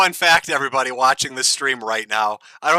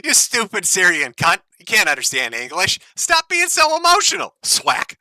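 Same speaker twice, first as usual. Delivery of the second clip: mocking boyfriend; 6 semitones higher, 4 dB louder than usual.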